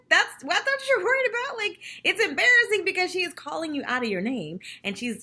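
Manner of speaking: high, loud, laughing "what?"; laughing disbelief. Retold or imitated. Host reacting to Sonia's confessional, high incredulous laugh. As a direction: crack up in disbelief. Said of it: "'Cause I'm like".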